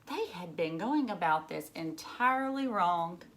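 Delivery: put-on lively read-aloud for children; storybook reading. A narrator's reading. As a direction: read it like a picture book to a class. Reads picture book aloud to children.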